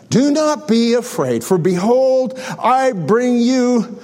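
normal talking voice

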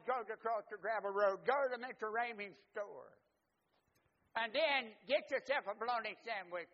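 high, loud, bossy big brother; big brother instructing. Retold or imitated. Older brother instructing his little brother at recess, high and loud. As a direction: tell him exactly what to do.

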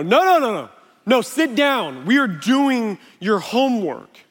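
put-on exasperated father ordering a child to sit down; dad losing patience with a crying son over homework, firm and loud. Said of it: "And I exasperated my child".

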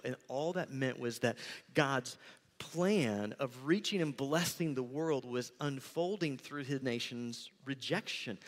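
usual speaking voice

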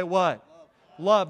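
loud prompting what, then a loud Love; rousing fill-in-the-blank call. Real, not acted. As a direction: call out the answer to the congregation. Preacher prompts congregation to answer.

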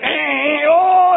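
high loud eager grunts; mock sadistic relish; gleeful dentist grunt. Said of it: "He's like".

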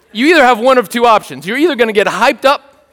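ordinary delivery

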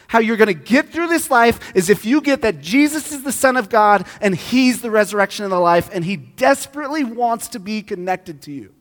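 high, loud pulpit preaching; full-force preaching. Acted. Pastor urging the congregation, preaching hard. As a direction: drive the point home to the room.

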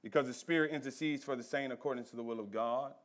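very low, very soft sermon voice; quiet, weighty counsel with long pauses; hushed solemn preaching. Acted. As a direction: bring the congregation down to a grave hush. Drops back to hushed preaching.

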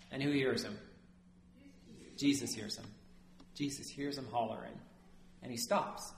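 normal talking voice

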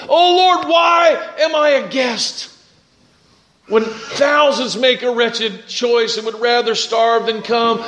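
high, fervent cry to God; impassioned cry. Acted. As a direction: cry out the hymn in wonder. Cries out psalm and hymn lines.

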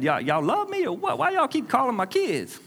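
high-pitched, playful protest; mock hurt complaint. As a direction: whine to your parents in jest. Mock complaint to his parents.

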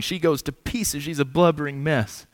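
ordinary talk, nothing marked

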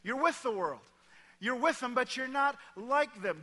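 emphatic. Shown as a demonstration. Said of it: "You need to hear that".

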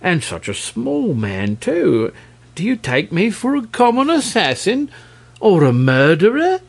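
indignant dragon, pitch leaping upward; object, voice climbing high on the questions; reproachful. A narrator's reading. Dragon refusing to be an assassin, reproachful. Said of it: "in a reproachful voice".

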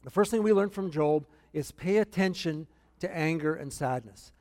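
usual speaking voice

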